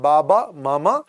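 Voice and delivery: normal talking voice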